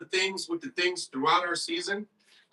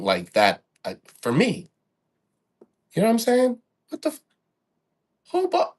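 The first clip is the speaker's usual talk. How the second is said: angry rant; 7 dB louder than usual. Real, not acted. Ranting at co-host on stream.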